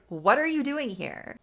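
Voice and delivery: mildly hammed-up hostile demand; angry challenge. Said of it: "He's immediately angry".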